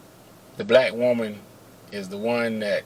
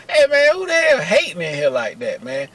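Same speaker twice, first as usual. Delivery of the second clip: mock outraged complaint; indignant call-out; 3 semitones higher than usual. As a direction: confront the whole kitchen, half in jest. Retold confrontation of his co-workers.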